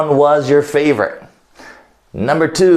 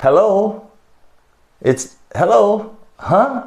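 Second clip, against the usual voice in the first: confused caller; 6 semitones higher than usual. Acted. Acting out a dropped call.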